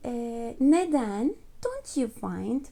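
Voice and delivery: usual speaking voice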